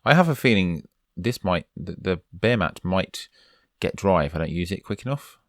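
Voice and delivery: ordinary talk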